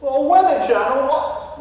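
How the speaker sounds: puzzled English gentleman ghost, hammed up; mock English accent. Ghost Nigel echoes the realtor in a fake English accent. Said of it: "a Texan trying to emulate an Englishman".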